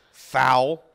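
usual speaking voice

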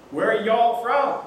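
a curious local leaning in; friendly Southern stranger. Retold or imitated. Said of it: "said a friendly voice".

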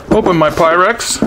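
ordinary delivery

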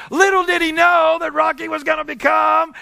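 high, loud triumphant call; preaching climax. Real, not acted. Preacher to congregation, crying out Rocky's win in triumph. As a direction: lift the whole room with it.

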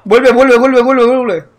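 loud repeated call back; urgent shouting. Real, not acted. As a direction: yell him back fast. Calling teammate back.

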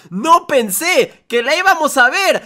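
very high, excited gushing; thrilled and caught off guard; real surprise. Real, not acted. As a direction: gush in amazement, pitch soaring. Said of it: "Me agarró súper de sorpresa".

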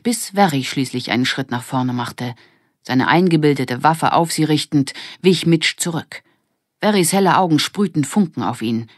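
ordinary delivery

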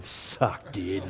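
low, mocking jeer of a dude; mocking mates. Mates on the speakerphone taunting him, low and mocking. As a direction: drag it out like teasing mates. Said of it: "all my mates sitting around a speakerphone going".

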